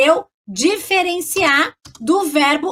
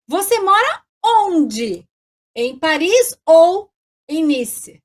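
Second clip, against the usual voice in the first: slow example; slower than usual. Shown as a demonstration. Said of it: "Pra vocês entenderem".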